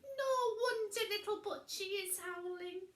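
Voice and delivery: high, loud upset witch voice; almost crying witch; high whimpering witch line; Winnie voice. A narrator's reading.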